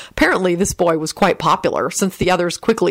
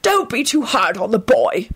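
raspy, wheezing plea, pitched up; Phineas Nigellus; 5 semitones higher than usual. A narrator's reading. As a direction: wheeze out a word for the boy. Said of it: "the raspy voice of Phineas Nigellus".

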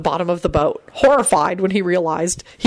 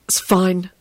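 ordinary delivery, nothing marked

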